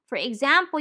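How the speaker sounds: ordinary talk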